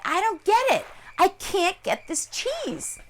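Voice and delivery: high-pitched baffled complaint; frustrated wolf. Acted. Wolf complaining to a crane, high and frustrated. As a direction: whine it up high, slowing at the end.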